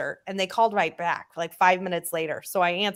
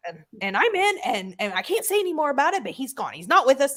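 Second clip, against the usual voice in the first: retold shout; 6 semitones higher than usual. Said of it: "why are you shouting at me".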